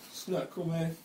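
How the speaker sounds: soft, subdued retold rebuke; quiet reproach. Classmate looking back at the blurter, quiet reproach.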